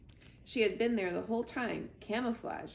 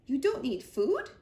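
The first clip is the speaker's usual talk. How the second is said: 1 surprised fox; 4 semitones higher, 6 dB louder than usual. Acted. Fink surprised.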